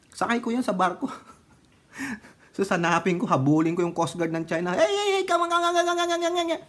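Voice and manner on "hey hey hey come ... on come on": high, hammed-up calls of wait; frantic mock shouting. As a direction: chase them, yelling for them to stop. Chasing Chinese coast guard, mocking. (pabirong sigaw na "wait, wait")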